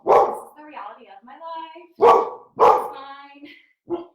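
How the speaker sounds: slow, loud, exasperated sigh of a line; exasperated and laughing it off. Real, not acted. Exasperated aside about dog.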